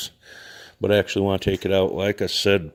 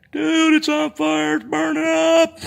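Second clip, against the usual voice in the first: very high, drawn-out alarmed squeal; mock panicked onlooker; 5 dB louder, slower than usual. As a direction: imitate people freaking out, slow and squeaky. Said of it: "see people being like".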